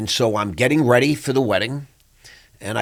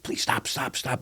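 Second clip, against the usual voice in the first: hushed, softer begging; pleading softly; 3 semitones higher, 9 dB quieter than usual. Retold or imitated. Wife quietly begging her husband to calm down. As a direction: quietly urge him to stop. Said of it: "Stephanie's like".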